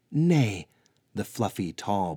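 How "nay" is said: stern refusal. A narrator's reading. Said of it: "Hirodrin, answered".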